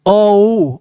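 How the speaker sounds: long held "अ उ" glide; drawn-out vowels. Shown as a demonstration. Teacher repeating the blend for students, drawn out. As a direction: let "अ" melt slowly into "उ". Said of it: "बोल के देखो".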